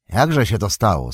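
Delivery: usual speaking voice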